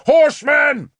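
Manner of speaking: high, loud shouted alarm; cried warning. A narrator's reading. Guard crying a warning from the wall, loud. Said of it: "the guard cried".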